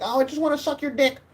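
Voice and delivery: very high falsetto woman; high, gushing mock-woman voice; imitated swooning woman. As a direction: put on a mock female falsetto. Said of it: "women would throw themselves on you and be like".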